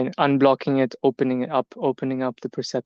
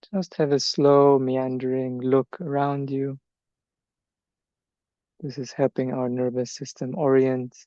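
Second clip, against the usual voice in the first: guided meditation. Acted. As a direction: ease them gently through each step, leaving space. Leads standing meditation.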